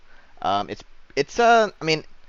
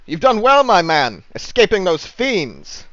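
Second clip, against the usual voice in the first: loud, high, rousing knightly declamation; hearty paladin; 8 semitones higher, 8 dB louder, slower than usual. Acted. Paladin congratulating an escaped prisoner, hearty and booming. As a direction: proclaim it grandly, drawing out the last words. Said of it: "and he says".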